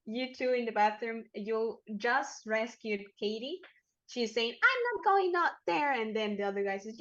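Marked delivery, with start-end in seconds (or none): 4.5-5.9 acted protest, pitched up and loud; high, loud refusal. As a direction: refuse in a raised, higher voice. Voicing a refusing character.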